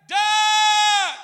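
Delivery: long, high full shout; shouted command. Retold or imitated. Captain warning rafters at the rapid's edge, big shout. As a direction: imitate a captain yelling a sudden warning.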